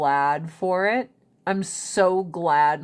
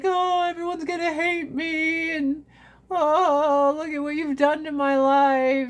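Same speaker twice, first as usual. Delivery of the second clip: higher hammed-up teen despair; dramatic teen; 7 semitones higher than usual. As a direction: whine it like a melodramatic teen. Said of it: "I remember I was like".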